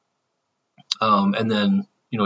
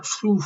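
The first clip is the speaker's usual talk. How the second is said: airy fireball whoosh sound; imitated whoosh; 10 semitones higher, 3 dB quieter than usual. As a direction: blow a soft rushing whoosh. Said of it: "like, kinda went".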